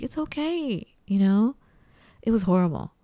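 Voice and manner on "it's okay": put-on high, soft comforting of a child; imitated soothing. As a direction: coo it gently to calm a child. Soothing her crying daughter.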